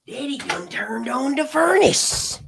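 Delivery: high, slow, full-voiced folksy drawl on Daddy done turned on the furnace; hammed-up folksy drawl. Speaker joking about the heat in the room, slow hammed-up drawl. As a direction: belt it out like a down-home joke.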